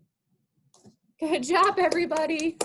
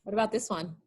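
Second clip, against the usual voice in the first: usual speaking voice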